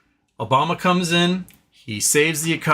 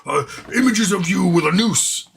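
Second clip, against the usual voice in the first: mock furious voter; 5 semitones higher than usual. Voters yelling at Democrats, hammed-up angry outburst. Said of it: "And we go".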